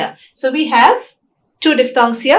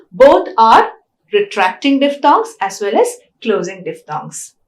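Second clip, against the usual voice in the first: ordinary talk, nothing marked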